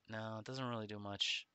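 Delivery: usual speaking voice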